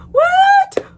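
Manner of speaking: very high, loud what; shocked shriek. Real, not acted. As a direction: shriek it in disbelief.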